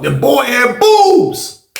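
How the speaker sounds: slow incredulous punchline; shocked reveal. Real, not acted. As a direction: stretch out the punchline in disbelief. Punchline reveal in prison story.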